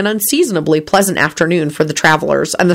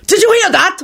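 roar; 14 semitones higher than usual. A narrator's reading. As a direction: shout to the next table. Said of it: "Hulbert roared".

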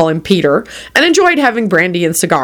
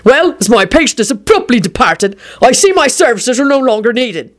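higher-pitched affronted formality; indignant outburst; 5 semitones higher than usual. A narrator's reading. Doctor about to stomp out, indignant outburst. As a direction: burst out with wounded officialdom. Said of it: "burst out Doctor O'Malley".

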